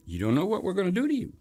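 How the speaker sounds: high-pitched, quick wake-up call; mock warning. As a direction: jolt the audience to attention, pitched high. Voices the film warning its audience.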